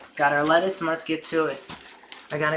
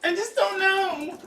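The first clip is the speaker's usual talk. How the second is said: high, slow whiny wail; mock whine; 13 semitones higher, 7 dB louder, slower than usual. Mocking his own complaining to his wife, high slow whine. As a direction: whine it like a helpless kid. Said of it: "And I'm like".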